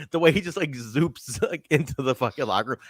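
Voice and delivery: normal talking voice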